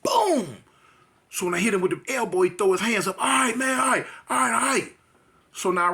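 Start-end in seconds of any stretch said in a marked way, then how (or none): 0.0-0.6 elbow boom. Retold or imitated. Elbow sound in a fight story. 3.2-4.9 surrendering. Retold or imitated. Beaten man giving up.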